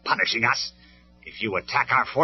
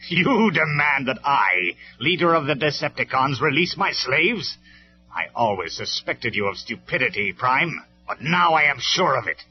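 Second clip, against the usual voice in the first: derisive; 7 semitones higher, 8 dB louder than usual. Acted. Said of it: "Megatron laughed in derision".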